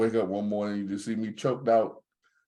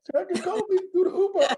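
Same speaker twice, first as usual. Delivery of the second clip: laughing; 5 dB louder than usual. Real, not acted. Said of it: "laughing while boasting".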